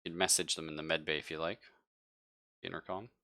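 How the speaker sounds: normal talking voice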